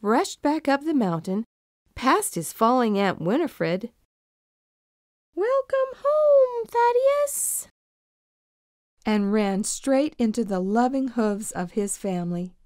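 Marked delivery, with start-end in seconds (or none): 5.3-7.7 very high, slow, far-off squeaky voices; a tiny high welcome drawn out slowly; distant goat greeting. Acted. The family on the mountaintop welcoming Thaddeus back in high squeaky voices.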